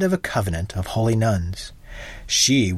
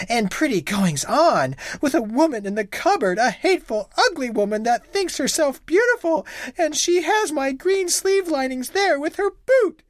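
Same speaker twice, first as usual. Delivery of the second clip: wife accusing her husband of a woman in the cupboard, rising higher; jealous wife. A narrator's reading. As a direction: build from scorn to jealous outrage.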